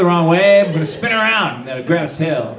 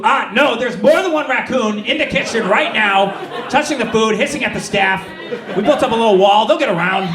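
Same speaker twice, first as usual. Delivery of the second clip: higher, breezy and matter-of-fact; casual attitude; 6 semitones higher than usual. Retold or imitated. Kitchen lady explaining the raccoons, higher breezy attitude. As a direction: shrug it off like raccoons are normal. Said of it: "she jumped straight to".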